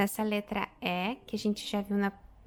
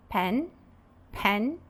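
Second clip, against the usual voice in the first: "pen" said firmly on its own; stressed syllable. Shown as a demonstration. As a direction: hit "pen" as the strong syllable. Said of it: "a sílaba mais forte".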